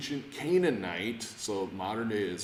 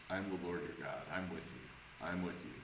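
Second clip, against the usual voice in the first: soft and tender, softer, like a hand on the shoulder; a quiet, tender promise, softer; gentle comforting voice of God; 5 semitones lower, 11 dB quieter, faster than usual. Pretend. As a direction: reassure someone lost at a fork in the road. Voicing God's comforting words at a juncture.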